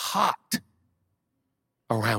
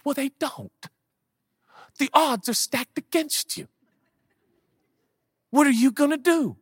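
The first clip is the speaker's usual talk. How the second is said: high taunting voice, growing louder; high sneering challenge; mocking doubter; 4 dB louder than usual. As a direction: goad them like the voice of doubt.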